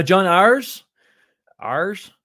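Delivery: "Ayers" drawled like "ours" in a Southern accent. Real, not acted. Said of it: "I just said ours, but in a Southern accent".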